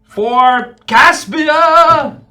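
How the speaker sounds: high, loud, long-drawn war cry; battle cry. Acted. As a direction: bellow it as you charge, stretching the name. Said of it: "And I go".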